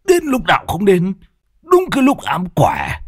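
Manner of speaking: cursing the bad luck, rising high; grumbling curse that flares up; annoyed cursing. Old Thoạt cursing the intruder who ruined his hand, irritated. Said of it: "Lão bực bội, vứt bài xuống, mặt cau có".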